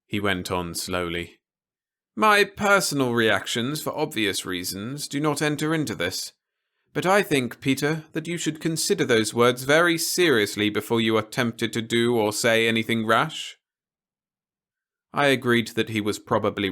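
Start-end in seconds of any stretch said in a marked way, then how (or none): 2.2-13.6 a lightly raised, measured officer's voice; stern officer. A narrator's reading. Officer warning Peter against anything rash, lightly voiced and grave.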